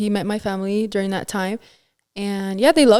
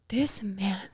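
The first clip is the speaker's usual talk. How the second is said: breathy whisper, slower; unvoiced, slowed thought under the breath; whispered aside; 2 semitones lower, 10 dB quieter, slower than usual.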